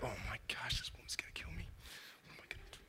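frightened whisper to himself; panicked whisper. Acted. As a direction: whisper it like he's terrified of her. Joseph panics privately about Mary.

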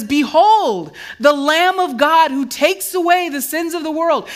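loud, high proclaiming shout; John the Baptist proclaiming loudly. Retold or imitated. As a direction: cry it out to the crowd. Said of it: "He shouts that out".

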